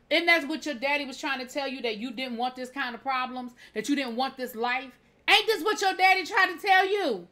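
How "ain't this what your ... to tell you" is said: louder, higher rhetorical jab; exasperated scolding. Real, not acted. Recapper scolding a character on screen, loud and high. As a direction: throw the question at him, fed up.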